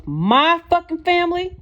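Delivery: high furious scream; top of his lungs. A narrator's reading. Sean screaming at his partner in jealous fury. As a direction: scream it with everything. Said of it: "At the top of his lungs".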